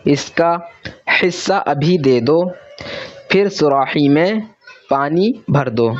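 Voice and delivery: slow, clear reading-aloud voice, every word set apart; slow lesson reading. Acted. Teacher reading practice sentences aloud to pupils, slow and clear. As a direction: read it out carefully for young learners to follow.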